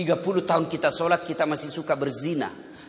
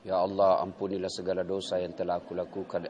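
insincere prayer; 10 semitones lower, 4 dB quieter than usual. Retold or imitated. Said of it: "seperti matanya pencuri".

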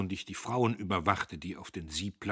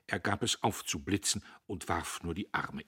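usual speaking voice

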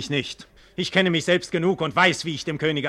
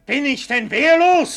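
much higher, loud outraged question; indignant outcry; 13 semitones higher, 7 dB louder than usual. Acted. Egmont asked for his sword, high outraged cry. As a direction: refuse to believe it, loud.

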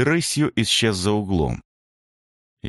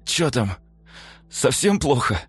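moaning; 7 semitones higher than usual. A narrator's reading. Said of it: "простонал он".

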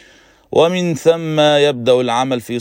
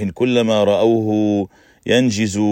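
plain reading voice

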